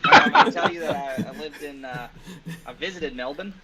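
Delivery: chatty Australian demo accent. Shown as a demonstration. Showing friends an Australian accent on request, relaxed Aussie voice. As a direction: talk like an Aussie from Queensland. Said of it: "hit us with your Australian accent".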